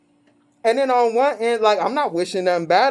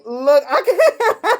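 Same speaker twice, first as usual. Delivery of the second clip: high, helpless laughing voice; words breaking up into drawn-out laughter; really cracking up, can't go on; laughing in tears; 10 semitones higher, 3 dB louder, much slower than usual. Real, not acted. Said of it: "laughing in tears".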